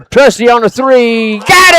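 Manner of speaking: building to a yelled got it; excited shout. Real, not acted. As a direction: hype up the made three. Call of another made three.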